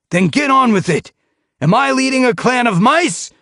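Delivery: harsh trainer shouting at his cats; berating shout. A narrator's reading. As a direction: tear into the lazy warriors. Leader scolding warriors.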